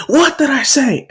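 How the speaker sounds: high, indignant demand; angry boss rebuke. Acted. Boss snaps at doubting crew.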